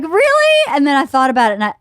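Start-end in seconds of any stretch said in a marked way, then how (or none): 0.0-0.6 incredulous. Retold or imitated. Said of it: "I was like".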